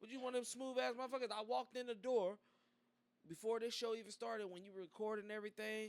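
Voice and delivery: loud, fired-up storytelling; excited. Real, not acted. Hyped recounting arrival, voice rising.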